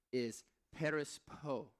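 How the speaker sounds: slow careful perispo, stress on first syllable; Greek word perispo. Shown as a demonstration. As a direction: say perispo slowly, PEH-ris-po with falling tune. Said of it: "That word distracted in the Greek".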